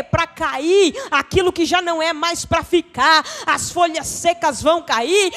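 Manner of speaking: loud, building sermon climax; full-force preaching. Real, not acted. Preacher building to a loud slow climax before the church.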